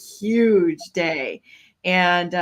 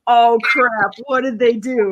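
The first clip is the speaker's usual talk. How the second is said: imitating a startled agent's higher, louder "oh crap"; mock panic into the radio; alarmed agent; 4 semitones higher, 6 dB louder than usual.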